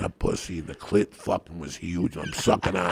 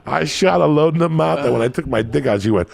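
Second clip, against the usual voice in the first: loud, raised-pitch punchline delivery; worked-up storytelling; 10 semitones higher, 8 dB louder than usual. Real, not acted. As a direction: shout the climax of the tale. Worked-up climax of his comic story.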